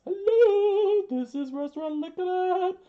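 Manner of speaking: very high, loud falsetto phone greeting; sing-out falsetto receptionist; Sanji. Acted. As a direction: answer in an exaggerated squeaky falsetto. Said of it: "remembered hearing Sanji's voice".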